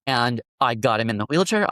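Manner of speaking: normal talking voice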